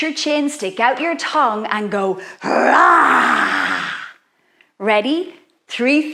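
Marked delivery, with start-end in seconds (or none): 2.4-3.9 deep growling lion roar. Shown as a demonstration. Teacher showing kids how to roar like a lion, deep roar. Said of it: "roar like a lion".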